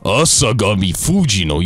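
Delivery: "Asagami Fujino" said firmly, falling at the end; confident "Asagami Fujino" with a falling finish; "Asagami Fujino" repeated the correct way, firm; right pronunciation. Shown as a demonstration. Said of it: "You gotta say it like that".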